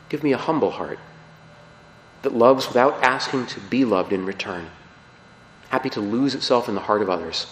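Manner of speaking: quiet devotional recitation; prayer. Acted. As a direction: pray it gently, pausing between lines. Reads a prayer aloud.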